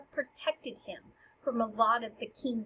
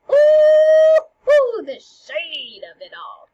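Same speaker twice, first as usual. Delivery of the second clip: high, loud drawn-out "ooh", then slow relish; playful, stretched-out gossip reaction; mock scandalized; 10 semitones higher, 15 dB louder, much slower than usual. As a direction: hoot at a savage comeback. Mock audience reaction to retort.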